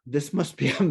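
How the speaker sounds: ordinary talk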